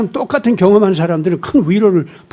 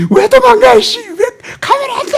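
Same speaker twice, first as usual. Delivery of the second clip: put-on shrill child yell, much higher; screaming toddler; 3 dB louder than usual. Toddler yelling at mother walking away, shrill. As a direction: wail like a kid being left. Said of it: "하고 또 소리 질".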